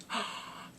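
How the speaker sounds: sharp acted intake of breath; voiceless, startled gasp; shocked gasp. Reacting to her being thrown.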